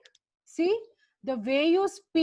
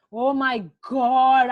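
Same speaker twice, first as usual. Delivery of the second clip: exasperated; 6 dB louder, slower than usual. Real, not acted. Teacher exasperated at wrong answer.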